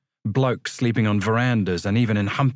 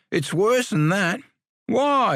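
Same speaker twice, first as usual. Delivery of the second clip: excited; 10 semitones higher, slower than usual. Acted. Excited cricket news exchange.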